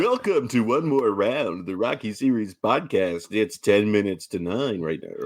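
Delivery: mock morning-show DJ patter; morning radio guy. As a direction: play the radio host announcing the time. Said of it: "a morning radio guy".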